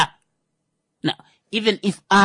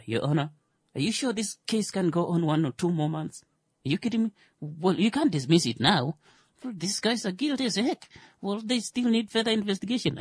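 hushed pleading lawyer; 5 semitones higher, 7 dB quieter than usual. Acted. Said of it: "The same lawyers will be like".

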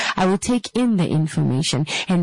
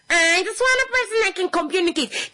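whiny mock demand; 13 semitones higher than usual. Mocking whiny demand again.

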